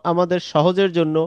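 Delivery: ordinary talk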